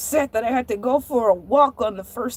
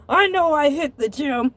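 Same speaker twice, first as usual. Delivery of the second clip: ordinary talk, nothing marked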